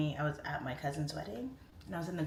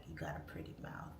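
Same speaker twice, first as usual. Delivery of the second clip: menacing movie villain; 12 semitones lower, 10 dB quieter than usual. Retold or imitated. Quoting the Deliverance scene to her chat, low and menacing. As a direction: lean in and leer the line, low and breathless. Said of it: "and they're like".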